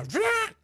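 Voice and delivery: high mock vroom of a soap dispenser; playful machine sound; vroom noise. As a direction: imitate the machine's whir. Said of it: "they go".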